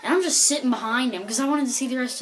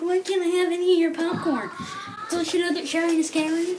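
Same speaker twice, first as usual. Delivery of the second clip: mock mad stranger; 7 semitones higher than usual. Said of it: "like, mad about it".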